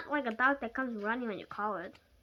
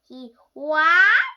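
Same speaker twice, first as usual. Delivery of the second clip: louder, higher shocked question; stammering in shock; 4 semitones higher, 15 dB louder than usual. Acted. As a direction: blurt in disbelief. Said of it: "she stammered".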